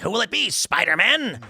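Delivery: sneering supervillain taunt; Green Goblin voice. Retold or imitated. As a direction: taunt like a cackling comic-book villain. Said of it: "You got a great Green Goblin voice".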